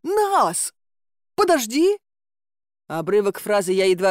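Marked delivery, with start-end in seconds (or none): 0.0-2.0 high, loud desperate call; a distant high shout to wait; calling after. A narrator's reading. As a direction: shout after someone running off. Grishka calls after her.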